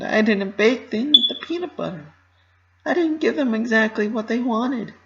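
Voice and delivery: high, bright pony voice, anxious and earnest; a young pony rushing through her worries, pitched high; Pinkie Pie voice. Acted. Pinkie confesses her failures.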